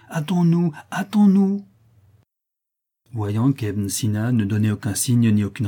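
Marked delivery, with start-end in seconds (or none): 0.1-0.7 higher, pressing hurry-up; first push to make him hurry; urgent plea. Acted. Disciple pressing his silent master, urgent and higher. 0.9-1.6 much higher and louder, nearly crying out; second hurry-up breaking into alarm; frantic plea. Acted. Disciple sensing something wrong, frantic and much higher. Urging dying master to hurry.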